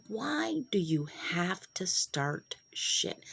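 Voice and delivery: slow, whispered impression of her husband; drawn-out hushed scolding from the husband. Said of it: "he's like".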